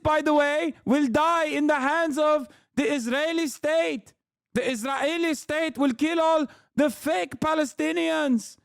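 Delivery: high-pitched, hammed-up Arabic-accented impression; mock Arabic accent. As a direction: parody the guest in a thick Arabic accent. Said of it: "He comes off as Palestinian Uncle Ruckus".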